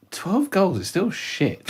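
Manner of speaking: slow, high unimpressed verdict; deadpan dismissal. Retold or imitated. Fan scoffing at Adebayor's twelve goals, slow and high.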